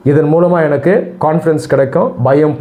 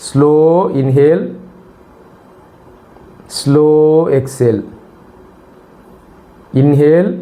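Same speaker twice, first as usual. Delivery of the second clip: drawn-out cues with long pauses, rising on inhale, falling on exhale; guided breathing. Acted. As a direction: pace slow breaths for listeners, word by word. Leads a breathing exercise.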